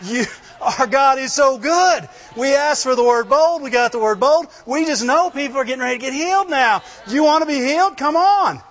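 high, eager rush of good news; real excitement. Acted. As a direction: burst out excitedly and rally people to come. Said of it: "talk like real excited people".

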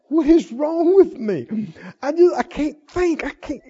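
hammed-up confused fretting; mock befuddled complainer. Preacher replaying the head-grabber who can't think, mock bewilderment. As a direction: stammer through a mock muddle, helpless. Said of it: "grab your head and go".

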